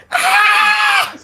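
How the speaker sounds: high startled scream. Acted. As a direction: let out a shrill scream. Villager screams at dragon.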